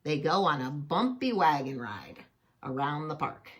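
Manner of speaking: ordinary delivery, nothing marked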